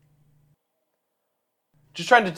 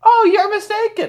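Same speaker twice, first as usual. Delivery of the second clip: much higher, overly polite voice; polite giant speech; 5 dB louder than usual. Acted. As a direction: play an eager host making small talk. PC answers ogre.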